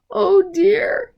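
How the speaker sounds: high loud cry of dismay; cried out. Acted. Mister Bird mourning his wife, high loud wail. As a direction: wail it in alarm. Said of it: "he cried".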